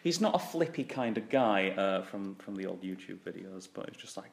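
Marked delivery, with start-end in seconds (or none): none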